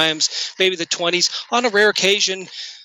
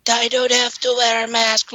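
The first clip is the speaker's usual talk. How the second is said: high, drawn-out spooky drone; ghost voice; 6 semitones higher than usual. Pretend. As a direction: moan it like a defiant spook. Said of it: "They're gonna be like".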